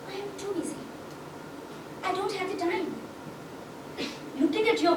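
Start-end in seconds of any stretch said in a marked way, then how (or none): 0.1-2.9 soft, higher-pitched businesswoman voice; quiet, lifted voice of a too-busy executive. Acted. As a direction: brush off the interviewer, high and quiet. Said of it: "A high-powered businesswoman was interviewed. She said".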